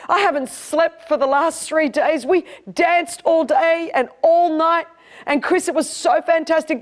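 high, gushing imitation of a friend; retold excitement. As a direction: play the elated friend, stressing each wonder. Retells friend gushing about rave.

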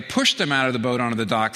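ordinary talk

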